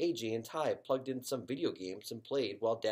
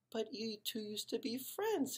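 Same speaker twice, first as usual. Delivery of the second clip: mother's voice; 12 semitones higher, 6 dB quieter than usual. Acted. Mother's gentle reproach voiced.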